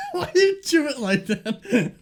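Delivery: laughing while asking. Real, not acted. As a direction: giggle through the question. Laughing at friend chewing.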